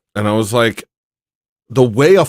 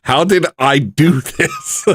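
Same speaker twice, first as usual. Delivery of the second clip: laughing; 6 semitones higher than usual. Real, not acted. Laughing greeting to listener.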